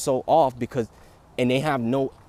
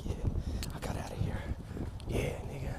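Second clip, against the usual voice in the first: soft gloating whisper; 11 dB quieter, faster than usual. Retold or imitated. Baby escaping the pool, whispered and gloating. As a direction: whisper it like you just escaped. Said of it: "making the face like".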